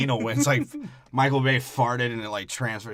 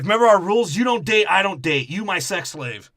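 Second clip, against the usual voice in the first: higher, louder mock dad; overbearing dad; 7 semitones higher, 5 dB louder than usual. Dad laying down rules to daughter, raised mock voice. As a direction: bark the rules at her in a raised voice. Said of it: "He's like".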